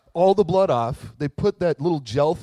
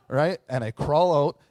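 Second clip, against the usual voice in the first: usual speaking voice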